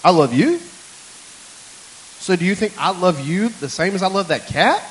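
loud, rising disbelief; incredulous retold questioning. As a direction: quote yourself questioning it, voice climbing. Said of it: "I said".

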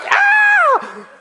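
very high, loud shriek; high scream effect. Acted. Preacher voicing the woman's shock in the tract with a shrill scream. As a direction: let out a piercing scared scream. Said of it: "I'm just sorry, sound effects".

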